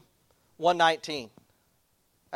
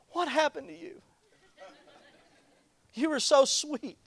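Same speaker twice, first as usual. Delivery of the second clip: higher, soft, slow wistful wife voice; teasing wife; 9 semitones higher, 5 dB quieter than usual. Retold or imitated. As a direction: gently chide him like his wife would. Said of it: "and she'd go".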